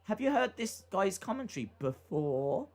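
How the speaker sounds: BEFORE drawn up into a question; imitated question intonation. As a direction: end on a rising, questioning "before". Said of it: "a question".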